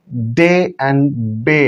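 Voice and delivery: डे and बे drawn out very slowly, each vowel held; very slow model words. Shown as a demonstration. Teacher slowly models डे and बे for students. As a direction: stretch डे and बे slowly for the class. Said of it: "यहाँ पे एकार आ रहा है".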